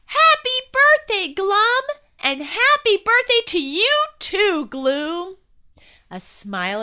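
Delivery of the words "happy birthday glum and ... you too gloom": very high, loud cheering; shouted birthday wishes. Acted. Friends to the twins, shouting happy birthday. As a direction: yell it together like a party crowd. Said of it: "they all shouted".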